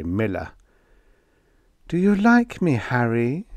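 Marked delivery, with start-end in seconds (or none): 1.9-3.5 woman's voice. A narrator's reading. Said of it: "she asked".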